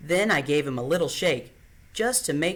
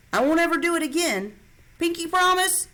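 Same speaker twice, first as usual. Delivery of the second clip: high, eager pinky-promise plea; pleading little boy; 13 semitones higher, 3 dB louder than usual. A narrator's reading. Little brother begs his sister for a pinky promise, high.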